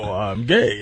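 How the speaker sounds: low, slow, deadpan reply; lightly voiced Takei deadpan; George Takei impression. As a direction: drop it low and flat like a dry celebrity. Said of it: "And he goes".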